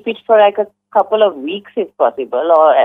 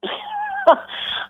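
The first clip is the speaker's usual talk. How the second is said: quick, much higher burst of laughter; short laugh; 7 dB quieter than usual. Real, not acted. Listener laughs at the prank caller's rum tasting, short high laugh. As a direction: laugh out at the silly story.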